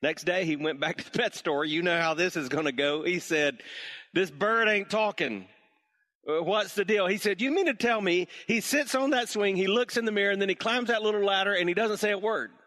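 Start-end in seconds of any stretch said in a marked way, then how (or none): 4.1-7.1 higher and louder, fed up with the bird; exasperated customer. Acted. Customer complains third time. 7.4-12.5 higher and louder, hammed-up amazement; mock disbelief. Owner feigns disbelief again.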